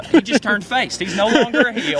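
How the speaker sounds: high, loud wrestling-fan excitement; excited fanboy. Retold or imitated. Fan retelling his reaction to the coach's walkout, high and excited. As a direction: call it like a thrilled wrestling fan. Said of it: "And I was like".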